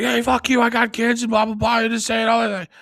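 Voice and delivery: retold shouting match, hammed up; mock angry yell. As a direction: bark it back the way they yelled at him. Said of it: "they just try to yell at me".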